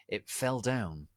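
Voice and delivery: ordinary delivery, nothing marked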